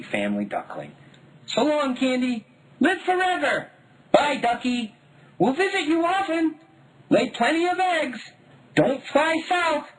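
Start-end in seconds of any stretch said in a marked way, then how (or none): 1.5-9.9 put-on high, sing-song kids' farewell; small children calling goodbye to a duck; child voices. A narrator's reading. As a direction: chirp goodbye like excited little children. Kids waving goodbye to duckling.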